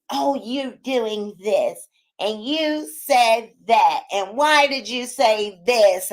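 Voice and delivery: slow, drawn-out mock complaint; exaggerated slow mimicry of critics; mocking commenters. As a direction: drag each word out to mimic a nitpicker. Mimicking nitpicking commenters at her.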